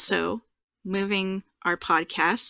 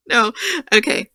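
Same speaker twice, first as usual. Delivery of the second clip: laughing; 11 dB louder than usual. Real, not acted. Cracking up during announcer attempt.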